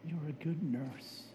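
soft, gentle, sugary praise; sweetest voice. Retold or imitated. Patient softly flattering the cranky nurse, sweet and soft. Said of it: "in my sweetest voice".

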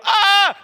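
loud, high scream of alarm; startled scream. Acted. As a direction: shriek as if someone is leaping at you. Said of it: "in time for me to go".

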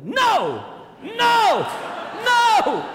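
hammed-up cry of protest; mock shouted no. Pastor acting his imagined reaction to son's calling, mock shout. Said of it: "I wanted to go".

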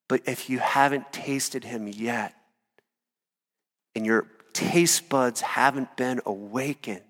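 soft, slow, tender pleading; quiet, tender invitation; gentle appeal. Real, not acted. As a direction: speak softly to the seeker. Gentle appeal after shouting.

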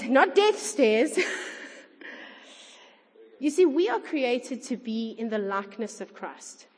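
ordinary talk, nothing marked

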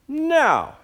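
high defiant no; a child digging in; defiant kid. Retold or imitated. Kid refusing again.

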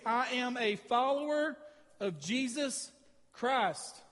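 follower stretched out, with Jesus and Christ each set apart by a pause; slow emphatic vow. Shown as a demonstration. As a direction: say the confession slowly, one phrase at a time. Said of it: "And then you're saying this".

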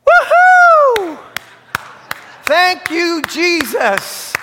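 high, loud joyful whoop of thanks; excited whoop. Acted. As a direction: burst out like a man overjoyed at his gift. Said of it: "this is an emphatic, excited guy".

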